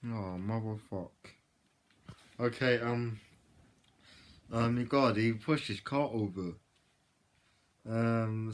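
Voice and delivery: ordinary talk, nothing marked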